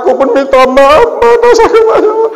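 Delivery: hammed-up wailing apology, very high and loud; suami menangis, ditirukan. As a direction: bawl it out, climbing into a mock wail. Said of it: "suami pula nangis-nangis minta maaf". (tangisan suami yang dilebih-lebihkan)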